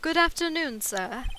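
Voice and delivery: loud formal greeting, dropping on "sir"; bellboy voice. Acted. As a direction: play a formal hotel bellboy. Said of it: "I am the bellboy".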